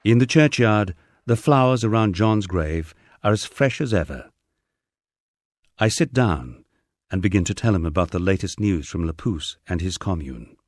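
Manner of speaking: ordinary delivery, nothing marked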